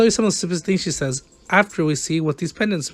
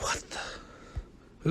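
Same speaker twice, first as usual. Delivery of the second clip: under-breath mutter; 18 dB quieter than usual. Real, not acted. Reliving rage, under breath.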